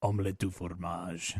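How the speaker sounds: slow, exaggerated French accent put on as a joke; hammed-up French accent. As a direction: drag out the words in a cheesy French accent. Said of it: "the closest to a French accent as you can do".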